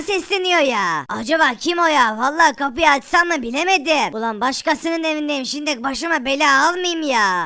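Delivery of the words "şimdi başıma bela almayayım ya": ordinary delivery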